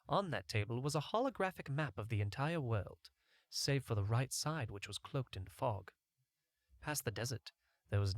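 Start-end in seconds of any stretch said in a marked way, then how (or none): none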